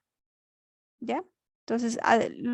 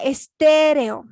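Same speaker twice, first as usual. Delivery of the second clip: estéreo with a loud, raised, held "té"; stress on antepenultimate syllable; 4 semitones higher, 8 dB louder, slower than usual. Shown as a demonstration. Teacher giving an esdrújula example, strong stress on "té" in estéreo. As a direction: punch and stretch "té" in estéreo. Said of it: "antepenúltima".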